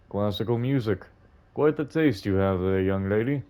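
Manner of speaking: put-on old man. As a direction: speak as a gentle elderly gentleman.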